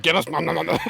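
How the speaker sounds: mock eager munching noises; greedy gobbling. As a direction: imitate yourself gobbling greedily. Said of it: "straight away, I was like".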